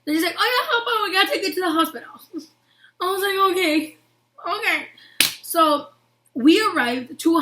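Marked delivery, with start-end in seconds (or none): 0.4-2.1 mock-casual, high and rushed; offhand ambulance crew. Ambulance crew brushing off hurt kids, mock-breezy. 3.5-4.9 small, higher-pitched okay; retold helpless going-along, pitched up; meek acceptance. Hurt child answering ambulance crew, meekly accepting.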